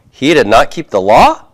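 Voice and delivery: "He did not keep the law?" rising on "law"; rising question tune. Shown as a demonstration. Teacher repeating the rising yes-no question for students. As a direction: let the pitch climb up to "law". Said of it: "have a rising intonation at the end".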